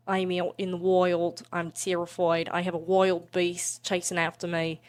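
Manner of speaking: rough work-in-progress Australian accent; practised but unfinished Australian accent; Aussie accent. Shown as a demonstration. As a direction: try an Aussie wildlife-adventurer accent. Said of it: "I'm working on Aussie".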